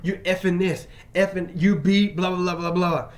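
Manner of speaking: a retold tirade, pushed up loud and high; mock yelling. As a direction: throw his cursing back hammed-up and loud. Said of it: "He continues to raise his voice".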